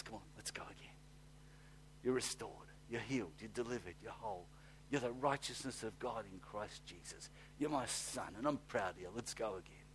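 very soft, near whispered reassurance; a proud father lifting up his son, softly; tender fatherly voice. Retold or imitated. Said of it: "He just says".

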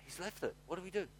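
quiet, baffled angels; mock bewildered angels. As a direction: angels left hanging, at a loss. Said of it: "the angels are going".

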